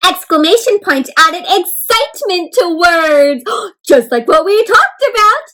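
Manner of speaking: loud, high, thrilled teacher; big bubbly emphasis; excited. Shown as a demonstration. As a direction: burst with excitement over the words. Demonstrates exclamation point's excitement.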